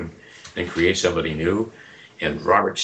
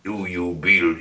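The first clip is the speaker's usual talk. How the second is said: minister voice; 2 semitones lower than usual. Shown as a demonstration. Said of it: "the voice of the gourd-head minister".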